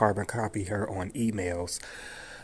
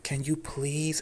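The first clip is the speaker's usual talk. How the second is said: mimicked polite plea, pitched higher; polite, scared plea; 6 semitones higher, slower than usual. Auditor asking caller to go elsewhere, polite plea. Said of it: "She was very polite".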